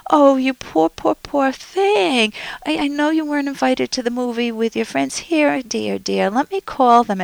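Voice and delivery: put-on a smothering mother fussing over her sad child; smothering parent. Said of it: "a parent can be smothering".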